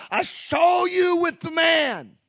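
high, loud and slow, pointing the finger; accusing shout. Retold or imitated. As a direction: shout the accusation across the room. Maid's accusation repeated, shouted.

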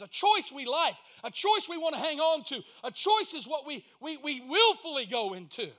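loud, high, hammering sermon voice; preaching at full force. Acted. Preacher hammering the congregation about choice, loud and high. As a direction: drive every choice home.

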